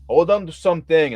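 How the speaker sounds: loud urgent call over comms; urgent warning. Acted. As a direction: warn the crew to brace.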